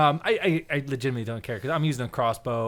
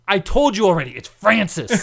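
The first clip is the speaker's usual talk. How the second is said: loud, higher acted exasperation; exasperated retort; 4 semitones higher, 6 dB louder than usual. Coworker correcting the storyteller once more, exasperated retort. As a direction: insist on it, fed up.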